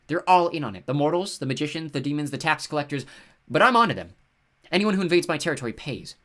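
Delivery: paranoid rant. Acted. Paranoid goddess ranting.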